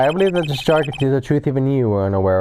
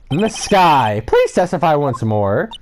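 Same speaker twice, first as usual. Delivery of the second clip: ordinary talk, nothing marked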